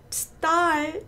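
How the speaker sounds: STAR with a long open "ah" before the r; slowly modelled STAR, r held back; drawn-out American star. Shown as a demonstration. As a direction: stretch the vowel in STAR, curl the r late. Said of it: "발음했을 때 어때요?".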